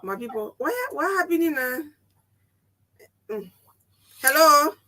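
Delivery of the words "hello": ordinary talk, nothing marked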